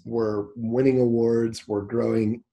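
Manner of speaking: normal talking voice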